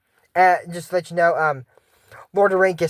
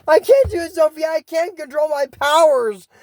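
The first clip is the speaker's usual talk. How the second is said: mock panicked cry; 11 semitones higher, 3 dB louder than usual. Acted princess panicking.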